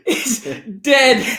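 high voice breaking into real laughter; helpless laughter through the punchline; cracking up. Real, not acted. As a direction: barely get it out for laughing. Cracks up telling dream.

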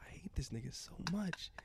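slow, soft unvoiced whisper. Real, not acted. Joking about his friend behind his back, in a whisper. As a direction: mutter it under your breath so he can't hear.

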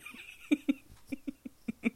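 high-pitched burst of laughter. Real, not acted. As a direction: crack up at your own joke. Breaks into laughter at nickname.

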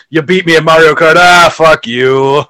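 playful sore-loser jibe among friends; friendly joshing. Retold or imitated. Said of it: "you see people, like, hanging around".

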